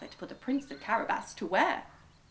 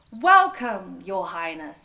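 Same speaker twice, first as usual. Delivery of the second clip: louder, formal greeting; a touch of grand welcome; 2 semitones lower, 11 dB louder, slower than usual. A narrator's reading. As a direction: greet the king with ceremony. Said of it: "he said".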